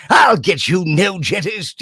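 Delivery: shouted cartoon villain threat; high, loud cartoon baddie yelling; Grandmaster Glitch. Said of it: "the naughty person called Grandmaster Glitch".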